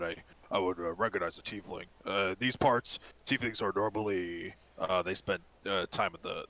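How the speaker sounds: a hint of an evasive bartender character, rambling; half-orc bartender. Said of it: "the half-orc kind of".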